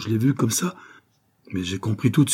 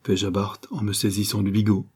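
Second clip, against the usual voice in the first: ordinary delivery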